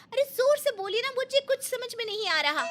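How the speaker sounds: daughter-in-law fakes deafness to the mother-in-law; mock shout. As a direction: shout back as if hard of hearing. Feigning deafness, shouting back.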